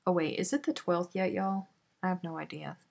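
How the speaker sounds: ordinary talk, nothing marked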